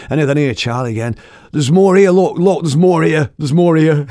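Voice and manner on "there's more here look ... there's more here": high, loud panicked cry; proper panicking. Retold or imitated. Wife panicking as she finds more snake skin, loud and high. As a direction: yell it in a high panic, over and over. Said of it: "Proper panicking".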